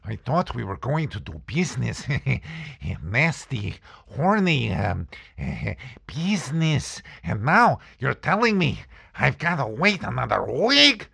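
lightly put-on whiny voice with laughs; frustrated complaint. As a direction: whine about the delay. Complains to girlfriend about waiting.

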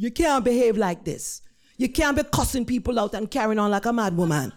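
scolding Jamaican nurse; exasperated Jamaican-accented reprimand; thick Jamaican accent. Retold or imitated. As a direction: tell her off in thick Jamaican. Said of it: "in a thick Jamaican accent".

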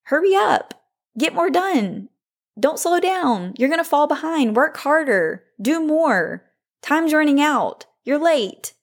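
high, pushy mock voice of her inner critic; nagging inner voice. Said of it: "what are the voices in your head saying to you".

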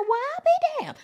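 high loud surprise; shocked exclaim. Retold or imitated. Fan seeing Shine appear, high loud surprise. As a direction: yelp it out amazed.

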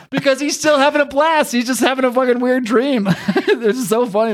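high-pitched, talking through laughter; real laughing speech. Real, not acted. Host cracking up at Dragon having a blast, laughing. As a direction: crack up while explaining the joke.

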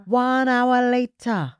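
slow, loud caption voice; announcer caption. Acted. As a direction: announce it like a title card. Narrator caption in skit.

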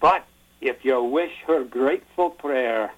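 Scottish brogue recital, slow, drawn-out line endings; best Scottish accent. Shown as a demonstration. Speaker reading Burns' Address to a Haggis aloud, Scottish accent. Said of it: "do my best Scottish accent".

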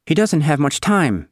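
put-on high, slow, anxious girl voice; fretting she's about to lose it; high, slow and close to panic; near hysterical. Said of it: "bordering on the hysterical".

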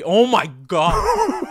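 pained gasp from hot wing; reeling from the heat; mouth burning from spicy food. Real, not acted. Biting the Carolina Reaper wing.

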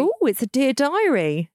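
drawn-out teasing "dear diary"; playful sing-song. Real, not acted. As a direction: tease it in a sing-song lilt. Teasing the audio diary idea.

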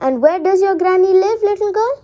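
lightly put-on sweetness, pitched high; wolf trying hard to sound nice; forced nice smile. A narrator's reading. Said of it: "trying to sm-, hard to smile nicely".

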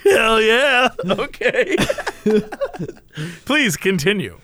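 normal talking voice

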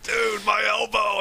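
high, slow, strained shout; pained protest. Retold or imitated. Bandmate with a bad elbow shouting at him after the bow, pained high yell. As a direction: cry it out like your arm is being wrenched. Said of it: "he's like".

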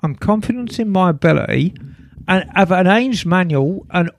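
hammed-up Essex accent. Reading Chris from Essex's email aloud, put-on Essex accent. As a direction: read it out like an Essex bloke. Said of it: "Can you do that accent".

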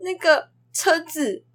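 put-on slow, high, tearful child's plea; crying while talking. Teacher imitating a child crying to get the car. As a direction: imitate a kid sobbing out his request. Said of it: "他就边哭边说".

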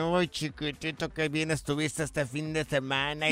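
flirty cooing. Retold or imitated. Wife flirting with lover clown.

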